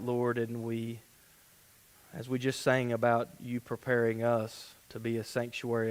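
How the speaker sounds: low, hushed, level praying voice; prayer. Real, not acted. Pastor leading the congregation before Bible study, soft low prayer. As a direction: pray softly and reverently, pitched low.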